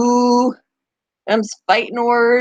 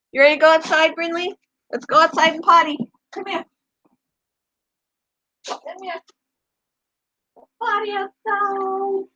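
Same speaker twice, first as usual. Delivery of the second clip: higher sing-song dog talk; calling the dog; 8 semitones higher than usual. Real, not acted. Owner coaxing her dog Brinley outside in a higher coaxing voice. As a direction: coax the dog out to potty.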